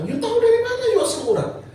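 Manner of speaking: very high, quick question; incredulous doctor. Retold or imitated. Doctor questioning the patient's self-diagnosis of gout, high incredulous voice. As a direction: fire it back in high-pitched disbelief.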